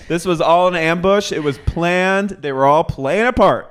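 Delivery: very high, loud, stretched-out alarm; hammed-up outrage. Viewer realizing it was an ambush, high mock-outraged cry. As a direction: cry conspiracy, stretching the key words. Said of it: "this is when I went".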